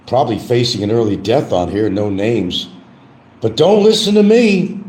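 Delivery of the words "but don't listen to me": sing-song catchphrase. Pretend. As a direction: belt it high and hold the last word. Repeats mock catchphrase.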